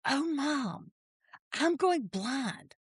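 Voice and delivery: whiny mock-innocent teen, high and soft; feigned helplessness. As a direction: play the helpless kid, voice lifted high. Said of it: "like she had no clue".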